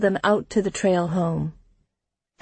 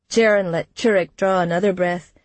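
ordinary delivery, nothing marked

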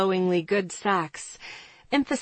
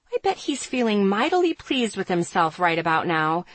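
ordinary delivery

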